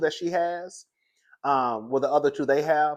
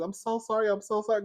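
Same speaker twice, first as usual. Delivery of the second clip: apologizing neighbour; 7 semitones higher, 4 dB quieter than usual. Retold or imitated. Dog owner passing scared neighbour, imitated high apology. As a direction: mimic her sorry-sorry in a raised pitch. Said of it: "She kept apologizing".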